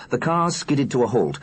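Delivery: plain reading voice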